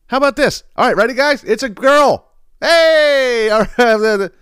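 high, loud party host cheer; mock reveal hype. Party host shouting the reveal to guests, hammed-up cheer. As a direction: ham up a gender reveal announcement.

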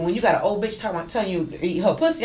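ordinary talk, nothing marked

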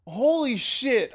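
slow, high, loud holy shit of disbelief; drawn out in sheer shock; stunned awe. Real, not acted. Reacting to MJF's promo, slow stunned disbelief.

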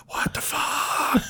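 slow breathy what the fuck, high and hushed; stunned, barely voiced disbelief; a dazed whisper while tripping; bewildered whisper. Wandering to bedroom confused.

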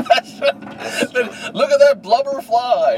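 high, loud, slow Homer Simpson impression. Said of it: "Homer Simpson style".

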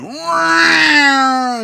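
high loud yelp; startled shout. Retold or imitated. The man in the car yelling as he flees. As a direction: let out a sudden loud yelp. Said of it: "and he went".

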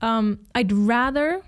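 normal talking voice

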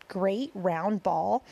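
ordinary delivery

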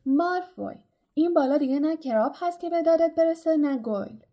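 high, loud taunt, easing at the end; shouting. A narrator's reading. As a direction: call out the name, then rush the taunt. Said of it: "هری فریاد زد".

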